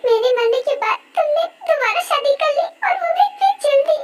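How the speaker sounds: high-pitched, tearful reproach; acted crying. [name] learns [name] married someone else, crying. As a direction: wail the betrayal through acted sobs. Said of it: "पर please तुम क्यों रो रही हो".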